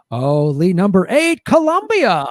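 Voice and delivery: countdown reveal soaring on the country name; punchy, swooping host announcement; game show host. Acted. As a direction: announce it like a grand prize. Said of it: "read about death squads in the game show voice".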